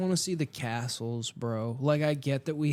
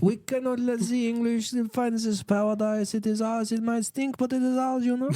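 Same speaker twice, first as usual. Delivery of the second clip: high-pitched hammed-up French accent; very high French caricature; mock French; 10 semitones higher, 4 dB louder than usual. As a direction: declare it proudly as a cartoon French colonizer. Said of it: "the Brits or the French".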